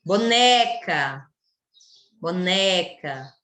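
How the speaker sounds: "Boneca" slow with a long, open NE; careful demo, strong NE in "Boneca"; stress on NE. Shown as a demonstration. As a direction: stretch the middle syllable of "Boneca". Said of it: "Né é a sílaba tônica".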